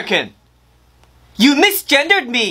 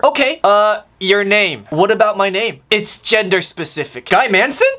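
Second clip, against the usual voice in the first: ordinary talk, nothing marked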